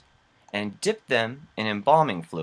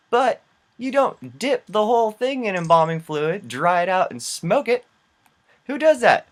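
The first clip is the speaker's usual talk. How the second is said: high-pitched, exasperated disbelief; real incredulity; 9 semitones higher than usual. Real, not acted. Host rants about dipping cigarettes, incredulous. Incredulous rant at absurd behaviour.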